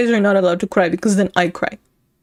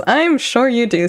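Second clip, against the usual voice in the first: normal talking voice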